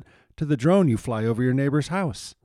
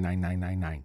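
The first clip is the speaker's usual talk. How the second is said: ordinary talk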